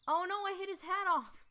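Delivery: blurting out an accident; dismayed surprise. Real, not acted. Player dismayed after knocking the hat off.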